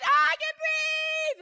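screamed very high and loud, drawn out slow; joyful shout. Retold or imitated. Healed lady shouting to the church, ecstatic slow scream. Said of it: "Pentecostal folk can scream loud".

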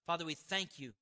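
hushed thanksgiving prayer voice. Acted. Closing prayer.

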